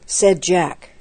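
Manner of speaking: ordinary delivery, nothing marked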